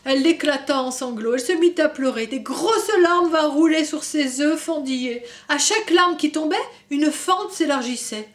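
put-on gentle storybook reading for kids; story narration. A narrator's reading. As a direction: read it like a bedtime book. Reading picture book to children. (lecture d'histoire pour enfants)

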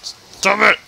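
loud high frustrated yell. Real, not acted. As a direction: shout it out in frustration.

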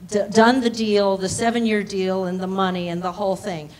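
normal talking voice

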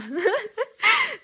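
a sudden loud, high burst of real laughter. Real, not acted. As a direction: let the laugh break out. Laughs at own words. (carcajada aguda y fuerte)

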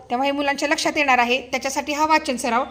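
ordinary talk

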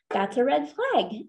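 ordinary talk, nothing marked

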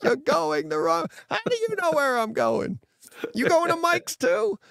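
squeaky high put-on voice, mocking a friend; a high, silly mock of John's jab. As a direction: pitch it way up like a teasing buddy. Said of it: "He's like".

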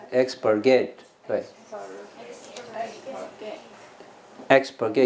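"expurgate" stressed on the opening EX; stress on first syllable of expurgate. Shown as a demonstration. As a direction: hit the EX in "expurgate". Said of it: "它重音是拉到A前面哦". (expurgate 重音放在第一音节)

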